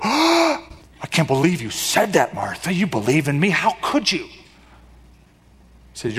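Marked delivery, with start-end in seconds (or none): 0.0-4.3 hammed-up scandalised disbelief; mock rebuke. Preacher acting a rebuke Jesus never gave Martha, mock appalled. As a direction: put on an exaggerated, appalled scolding. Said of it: "Jesus doesn't rebuke her and go".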